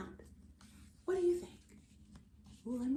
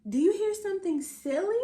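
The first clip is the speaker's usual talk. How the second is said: louder, quick, playful question; excited question; 6 semitones higher, 7 dB louder, faster than usual. Acted. Auntie asking the listening children, eager and loud. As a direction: tease the kids with it.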